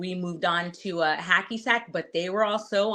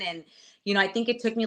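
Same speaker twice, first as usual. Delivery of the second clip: normal talking voice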